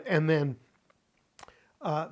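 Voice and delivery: usual speaking voice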